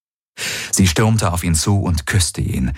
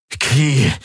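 higher, strained utterance; forced out; 7 semitones higher than usual. A narrator's reading. Returned lover calls her name, forced out. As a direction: struggle to get the name out. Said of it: "brachte Garland hervor".

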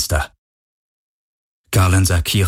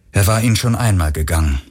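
ordinary delivery, nothing marked

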